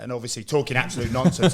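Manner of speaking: normal talking voice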